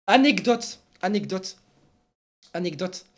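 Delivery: emphatic "anecdote", then settling down; practised pronunciation. Shown as a demonstration. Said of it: "m'habituer à dire anecdote".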